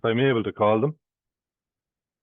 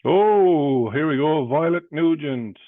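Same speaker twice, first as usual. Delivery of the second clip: excited; 4 semitones higher, 6 dB louder, slower than usual. Real, not acted. A winner checks in.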